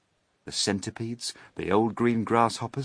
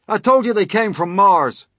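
high, loud insistence; loud I-told-you-so call; 12 semitones higher, 11 dB louder than usual. A narrator's reading. Police chief insisting to the fire chief, loud call. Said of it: "called the chief of police".

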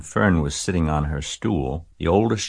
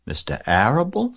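high, understated sob of disbelief; lightly sobbed question, pitched well up; sobbing; 9 semitones higher than usual. A narrator's reading. Pig learns the farmer is in the plot, understated sob. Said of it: "sobbed Wilbur".

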